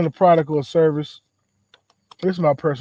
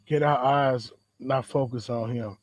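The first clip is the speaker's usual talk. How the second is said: ordinary delivery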